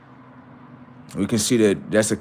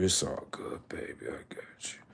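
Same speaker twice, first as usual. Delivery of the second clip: mock-tender, barely audible whisper; soothing whisper; 2 semitones lower, 18 dB quieter than usual. Comforting another attention seeker before a timeout, soothing whisper. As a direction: whisper it like hushing a baby.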